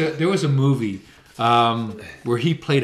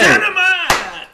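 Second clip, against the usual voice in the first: very high, loud, drawn-out catchphrase; catchphrase shout; 7 dB louder, much slower than usual. Acted. Co-host echoing JJ's Dynamite, catchphrase shout. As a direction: stretch out the catchphrase at full cry.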